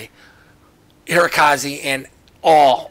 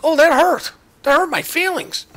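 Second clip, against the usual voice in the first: mock hurt; 12 semitones higher, 5 dB louder than usual. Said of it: "It was like".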